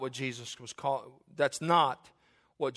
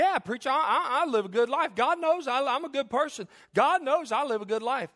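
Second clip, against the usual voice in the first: high, loud, indignant protesting; mock self-righteous; 12 semitones higher, 6 dB louder than usual. Man telling preacher he lives good, loudly self-righteous. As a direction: protest your goodness at the top of your pitch.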